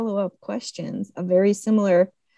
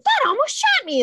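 surprised; 3 dB louder than usual. Retold or imitated. Voicing the character's scared remark.

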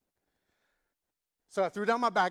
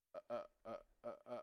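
very soft, lower halting stammer; stunned stammer; 7 semitones lower, 14 dB quieter, much faster than usual. Retold or imitated. Neighbor stunned by the prayer, soft speechless stammer. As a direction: lose all words.

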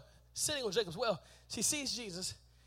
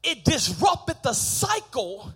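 loud, raised sermon delivery; full-force preaching; 8 semitones higher, 14 dB louder than usual. Acted. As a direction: drive each point home to the congregation.

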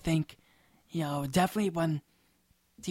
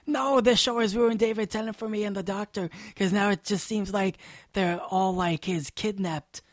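higher-pitched mock complaint; complaining fan; 4 semitones higher, 4 dB louder than usual. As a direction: imitate an aggrieved fan whining about a show. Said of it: "some Doctor Who fan complaining".

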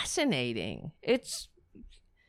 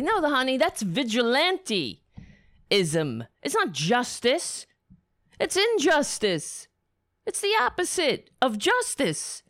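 raised, indignant rant; outraged; 5 semitones higher than usual. Real, not acted. Vlogger fuming at a congressman's lynching remark, outraged. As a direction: hammer home it's not justice.

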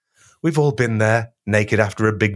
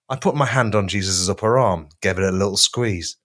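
ordinary talk, nothing marked

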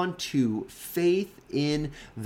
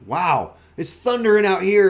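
louder, higher wowed onlooker; mock amazement; 6 semitones higher, 9 dB louder than usual. Bystander reacts to a voice from heaven, mock amazement. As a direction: exclaim it like an awed bystander.

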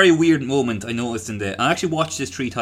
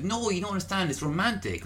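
lightly voiced girl, softer; a soft, lightly imitated protest; retold plea in a hushed voice; girl pleading her case; 6 semitones higher, 6 dB quieter than usual. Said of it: "this girl and she's like".

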